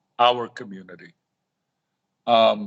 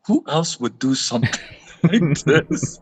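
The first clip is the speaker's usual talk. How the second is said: laughing; 3 semitones higher than usual. Retold or imitated. Telling Greg in the car, laughing. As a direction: chuckle at the coincidence. Amused remark leaving the house.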